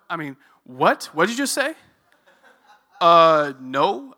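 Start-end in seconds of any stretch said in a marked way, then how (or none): 0.6-1.7 incredulous double question, rising high at the end; taken aback. Retold or imitated. Stunned reaction in retold debate. 3.0-4.1 loud, drawn-out "uh" before the no; comically thrown, loud hesitant answer; baffled refusal. Retold or imitated. Comic baffled answer in retold exchange.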